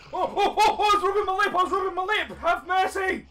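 high, loud shrieks edged with laughter; panicked yelling. Real, not acted. Begging the attacker for mercy, high panicked yelling. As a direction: plead at the top of your voice. Jump scare, begs mercy.

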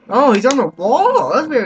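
high loud alarm; excited callout. Real, not acted. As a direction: shout the enemy's spot to the team. Spots enemy, calls out.

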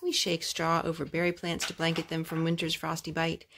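ordinary delivery, nothing marked

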